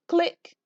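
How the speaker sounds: crisp little click, click; camera click sound. Acted. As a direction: mimic a toy camera clicking.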